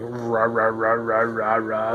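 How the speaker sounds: repetitive mock grinding "rah"; imitated grinding noise. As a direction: imitate a noisy computer grinding away. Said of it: "It's like grinding".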